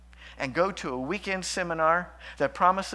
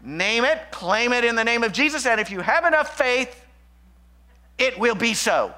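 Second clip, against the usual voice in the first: mock prosperity teaching; 7 semitones higher, 7 dB louder than usual. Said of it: "People that teach that".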